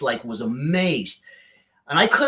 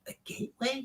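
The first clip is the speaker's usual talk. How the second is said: much higher, softer, rising "gateway"; incredulous rising "gateway"; surprised question; 10 semitones higher, 13 dB quieter than usual. Retold or imitated. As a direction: echo "A gateway?" in disbelief. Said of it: "I'm like".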